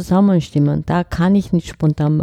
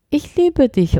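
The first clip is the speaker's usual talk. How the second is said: „Ich liebe dich“ deutlich höher und betont; higher, emphatic; 7 semitones higher, slower than usual. Shown as a demonstration. (hoch und betont gesprochen)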